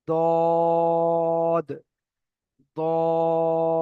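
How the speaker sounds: দদ with rounded lips and held vowel; round-mouth dad. Shown as a demonstration. Teacher to students, rounded দদ. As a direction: say দদ full and round. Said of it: "এর উচ্চারণ হবে".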